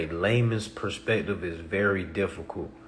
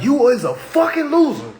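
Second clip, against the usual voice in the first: high, loud mock tirade breaking into laughter; imagined outburst, pitched way up and loud; snapping; 7 dB louder than usual. Speaker imagines berating people on a live, snapping in mock outrage. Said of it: "I'ma snap".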